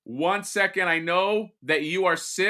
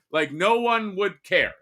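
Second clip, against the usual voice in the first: ordinary talk, nothing marked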